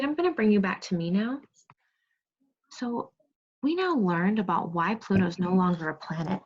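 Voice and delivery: ordinary delivery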